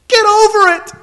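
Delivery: high, exclaimed dismissal; blunt rebuke. Acted. Preacher answering someone who won't praise, blunt rebuke. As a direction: tell the complainer off sharply.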